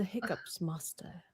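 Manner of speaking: hushed whisper. Acted. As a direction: whisper it under breath. Whispered aside to pet.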